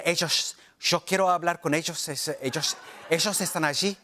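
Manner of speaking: Argentine Spanish, leaning on the SH sound in ellos; Argentine accent. Shown as a demonstration. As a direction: lean into the sh, Argentine. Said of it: "leaning in on the S H".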